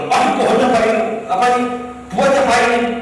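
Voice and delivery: loud, high mock admiration; acted exclamation. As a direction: exclaim loudly like an onlooker inspecting fruit. Acting out someone looking at a tree.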